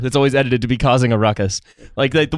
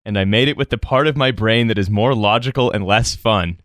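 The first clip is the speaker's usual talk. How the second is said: ordinary delivery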